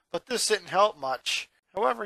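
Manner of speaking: usual speaking voice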